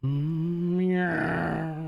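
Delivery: high, drawn-out TIE fighter whine; badly imitated spaceship flyby. Shown as a demonstration. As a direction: make a long rising engine noise with the voice. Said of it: "That doesn't sound like a tie fighter at all".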